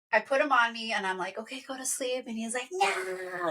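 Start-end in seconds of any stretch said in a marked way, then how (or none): 1.4-2.3 soft, slightly raised coaxing; coaxing parent. Retold or imitated. Mom to exhausted son on plane, soft coaxing. 2.7-3.5 high, drawn-out mock wail; imitated toddler scream. Exhausted son refusing sleep on mom, mock scream. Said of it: "he's screaming".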